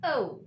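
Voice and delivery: O as a falling glide into "oo"; careful letter name O; gliding O. Shown as a demonstration. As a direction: enunciate O roundly. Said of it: "pronunciation of this letter is".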